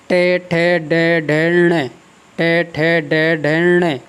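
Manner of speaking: का, खा, गा, घा, ण recited in a measured row; syllable drill. Shown as a demonstration. Teacher reciting the ट-row to show the nasal at its end. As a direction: chant the row slowly, landing on ण. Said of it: "इसी प्रकार आप देखें क्या".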